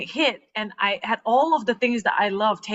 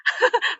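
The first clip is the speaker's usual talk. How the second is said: breathy laugh. Real, not acted. Guest laughing at her own delusion.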